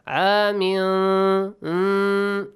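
عام with the final nun hidden in a long hum; ikhfa. Shown as a demonstration. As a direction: hold the end of عام slowly through the nose. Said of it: "hide the sound of the noon".

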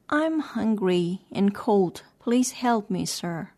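plain reading voice

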